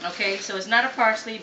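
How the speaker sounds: usual speaking voice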